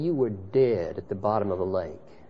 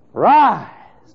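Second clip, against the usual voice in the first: loud, high command; commanding call; 10 semitones higher, 12 dB louder than usual. Acted. Heavenly voice commanding the dead Son, loud and high. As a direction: call him up from death. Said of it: "a voice from heaven saying".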